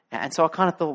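ordinary delivery

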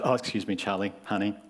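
lightly voiced haughty wife; mock correction. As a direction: correct him sweetly but pointedly. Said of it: "She goes".

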